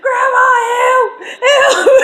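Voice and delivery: drawn-out, very high cry for help; retold panicked scream, loud and comic. Granddaughter chased by a goose calls to grandma, screaming. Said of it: "I'm screaming like".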